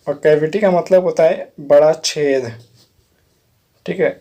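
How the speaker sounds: normal talking voice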